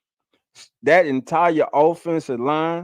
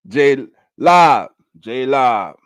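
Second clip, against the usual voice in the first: calling out; 7 dB louder, slower than usual. Real, not acted. Calling out a name.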